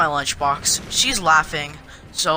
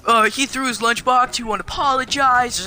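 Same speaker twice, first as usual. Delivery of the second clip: higher, mildly rude mimicry of the teacher; rude complaint; 6 semitones higher than usual. Teacher complaining to the principal about the lunchbox, mock rude. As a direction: imitate an accuser being rude, pitched up. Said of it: "she's being really rude".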